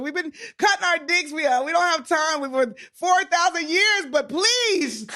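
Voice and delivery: high, hammed-up pleading, bursting into a shout; mock rabbi begging, pitched high; playful imitation of a rabbi pleading for his people; imitating a rabbi's plea. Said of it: "He said".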